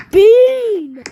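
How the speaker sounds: loud high callout "Bean!"; thrilled game shout; excited shout. Real, not acted. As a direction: yell out the find in delight.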